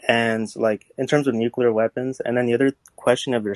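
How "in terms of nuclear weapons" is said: ordinary talk, nothing marked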